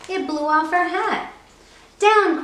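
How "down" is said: sharp loud high "Down!"; witch crying out. Acted. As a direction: call the broom down urgently. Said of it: "cried the witch".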